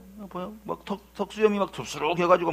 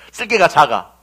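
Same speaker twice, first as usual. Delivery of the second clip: ordinary talk, nothing marked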